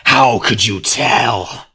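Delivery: slow, loud, snarled creature voice. A narrator's reading. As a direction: drag it out through bared fangs. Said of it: "the creature snarled".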